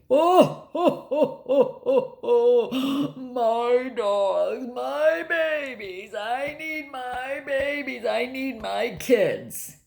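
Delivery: mimicking a father's fake cry over his dogs; mock sobbing. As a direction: wail like a man faking tears, dragging the words slowly. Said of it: "He fake cried".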